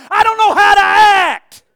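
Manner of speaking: loud slow mocking line; mock stiff guest. Preacher teasing a stiff congregation, loud mock. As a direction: shout it in mock. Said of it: "Y'all acting like somebody".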